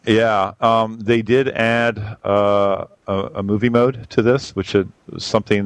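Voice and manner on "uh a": ordinary talk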